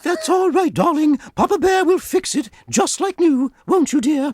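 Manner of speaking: high motherly bear, gently puzzled; Mama Bear answering Papa Bear about the soup. Acted.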